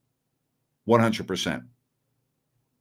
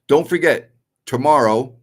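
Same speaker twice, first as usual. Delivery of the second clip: loud reminder; 4 semitones higher, 6 dB louder than usual. Real, not acted. Reminding viewers of tomorrow's games.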